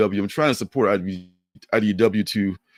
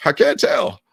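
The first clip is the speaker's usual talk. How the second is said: put-on higher, stammering old man; 7 semitones higher than usual. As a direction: put on a confused old-timer's voice. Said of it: "That's a really good old man voice".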